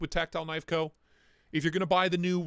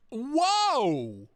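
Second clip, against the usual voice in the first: surprised whoa; 7 semitones higher, 4 dB louder than usual. Real, not acted. Sees a deal in chat.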